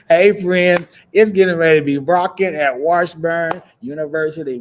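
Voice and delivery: loud, high-pitched cheer; excited hype. Real, not acted. Hyping up her new team.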